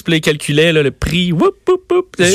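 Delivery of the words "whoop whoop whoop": high-pitched, playful "whoop" noises; little high whoops to mimic a dance; imitated dance whoops. Co-host mimics the ad man's little dance, high playful whoops.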